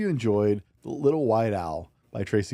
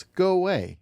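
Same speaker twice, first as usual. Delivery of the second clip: higher, sharper go-away from a haughty owl; snooty owl dismissal; 9 semitones higher than usual. Acted. Haughty owls sending the plain owl away, higher pitched. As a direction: shoo him off like a proud bird. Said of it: "You don't belong with us".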